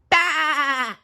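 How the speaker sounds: very high shouted burst; squeaky cry. Acted. Narrator adding SpongeBob flourish, high shouted squeal.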